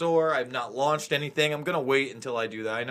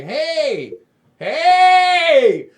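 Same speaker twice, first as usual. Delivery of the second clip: high, loud calling out hey; 13 semitones higher, 12 dB louder than usual. Retold or imitated. Host retelling warning outlets, calling out loudly. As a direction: shout for attention. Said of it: "to say".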